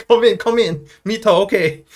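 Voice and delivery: high, loud taxi driver calling passengers back; mock exasperated, high-pitched invitation; driver giving in. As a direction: hurriedly wave the customers back in. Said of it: "they'd be like".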